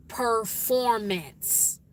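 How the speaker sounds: performance drawn out slowly with the R in "per"; careful, slow performance, every R sounded; clearly pronounced. Shown as a demonstration. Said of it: "I'm saying things clearly".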